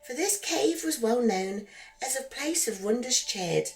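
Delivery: ordinary delivery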